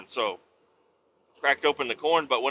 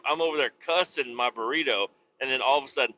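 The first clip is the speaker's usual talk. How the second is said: normal talking voice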